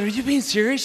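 can't believe what he hears; disbelieving question. Retold or imitated. Groggy disbelief.

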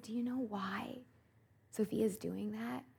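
soft whispered question. Retold or imitated. Mother whispering to the frightened girl, soft. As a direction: whisper it gently to the child.

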